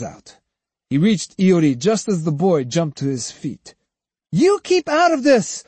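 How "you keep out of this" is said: high, loud defiant cry. A narrator's reading. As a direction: yell at the manager. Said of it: "Iori cried then".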